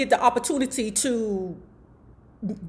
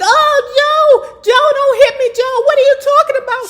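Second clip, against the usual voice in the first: high, loud panicked squeal, hammed up; mock whiny panic; 8 dB louder than usual. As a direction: squeal for Joe to stop like a scared man. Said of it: "Jack Gordon's like".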